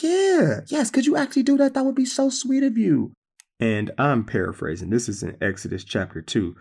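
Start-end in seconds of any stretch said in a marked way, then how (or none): 0.0-3.1 high, fast, sugary voice; sweet princess. Retold or imitated. Pharaoh's daughter accepting the sister's offer, sweet and high. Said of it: "She's like".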